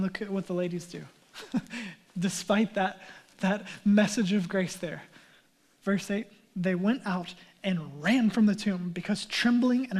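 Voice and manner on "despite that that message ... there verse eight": ordinary talk, nothing marked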